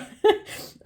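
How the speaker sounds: a short high laugh through held-back tears; teary laugh. Real, not acted. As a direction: laugh it off as the crying rises. Laughing through tears mid-talk.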